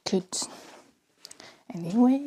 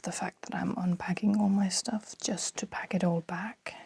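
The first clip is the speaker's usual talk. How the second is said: whispering. Real, not acted. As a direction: keep it hushed, mother in the next room. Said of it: "I don't know why I'm whispering so much".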